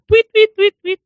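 very high chirping tweets; bird tweets. Retold or imitated. Cory hiding in tree from soldiers, chirping tweets.

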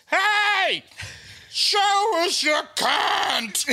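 high, slow hooting yell out a car window; mock lout yell. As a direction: drag out a high, hammed-up yell at a girl. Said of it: "and he goes".

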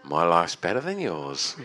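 low, slow smug gloat; low, slow gloat to a hungover friend. Pretend. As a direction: savour it over a baguette. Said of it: "and just go".

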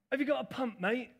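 a lightly voiced bloke on a bike asking a favour; cyclist's offhand ask, mildly mimicked; imitating the cyclist. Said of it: "Like that".

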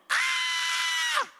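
shrill high-pitched shriek, far above his voice; girlish scream. Retold or imitated. Panicked disciple in the storm, girlish scream. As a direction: squeal like a frightened little girl. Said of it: "the disciples screaming like little girls".

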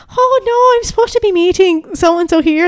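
high-pitched imitation Irish accent; Irish old lady. Old lady at the wrong car, high Irish accent. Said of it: "most beautiful Irish accent".

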